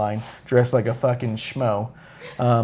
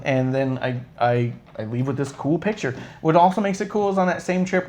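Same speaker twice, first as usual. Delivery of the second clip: ordinary delivery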